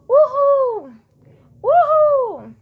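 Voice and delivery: high, loud cheering uhul; joyful shout of uhul; happy whoop. Shown as a demonstration. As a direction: cheer like on a rollercoaster. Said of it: "grito de felicidade ou adrenalina".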